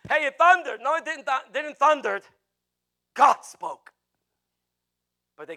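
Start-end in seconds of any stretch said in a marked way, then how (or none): 0.1-0.8 high, loud mock excitement; clueless bystander. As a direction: shout it like someone who missed the point. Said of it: "we just come up with some idea".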